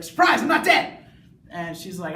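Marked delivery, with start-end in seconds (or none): 0.0-1.0 high, loud playful shout; spring it on him, loud and high; hammed-up woman popping up alive; mock vampire woman. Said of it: "And then she's like".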